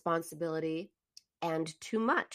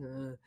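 sleepy grunt; 6 semitones lower, 12 dB quieter than usual. Retold or imitated. Husband's sleepy grunt imitated.

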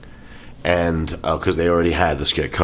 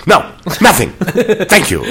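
retold curt refusal; 11 semitones higher, 5 dB louder than usual. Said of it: "and then he goes".

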